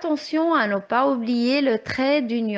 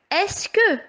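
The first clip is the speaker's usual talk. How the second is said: «Est-ce que» pitched much higher and set apart before the rest; stress on est-ce que; 8 semitones higher than usual. Shown as a demonstration. Teacher singling out the question word for the children. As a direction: hit «Est-ce que» high to point it out. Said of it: "on a le mot est-ce que".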